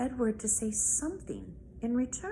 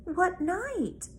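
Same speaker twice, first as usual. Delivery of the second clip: put-on higher, pressing little girl; girl pressing her grandmother for a date; eager child; 9 semitones higher than usual. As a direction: push for an answer like a curious child. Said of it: "asked Abilene".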